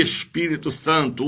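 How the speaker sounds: ordinary delivery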